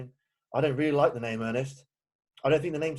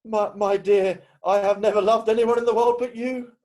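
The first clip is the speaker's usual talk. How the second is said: ardent declaration; 6 semitones higher, 6 dB louder than usual. Acted. Declaring love to Gwendolen.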